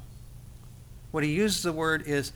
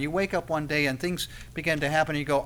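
ordinary talk, nothing marked

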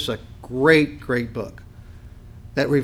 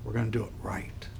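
whisper; 4 semitones lower, 12 dB quieter than usual. Retold or imitated. God gently starting over.

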